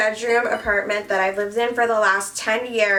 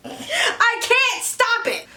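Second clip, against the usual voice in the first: much higher, drawn-out comic squeal; mock helpless wail; 14 semitones higher, much slower than usual. YouTuber can't stop her Canadian accent, high mock wail.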